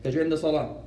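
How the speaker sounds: ordinary talk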